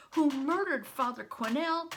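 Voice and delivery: ordinary delivery, nothing marked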